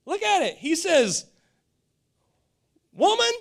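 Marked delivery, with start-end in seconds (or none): 2.9-3.3 high, loud, curt "Woman"; curt address. Retold or imitated. Jesus answering his mother, mock curt. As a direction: bark it out like a put-down. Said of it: "a little offensive".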